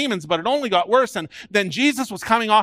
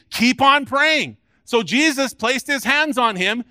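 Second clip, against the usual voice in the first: high, forceful exhortation; impassioned preaching; 5 semitones higher, 4 dB louder than usual. Real, not acted. Preacher urging the congregation to keep praying, preaching forcefully. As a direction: preach it out, urging them to persist.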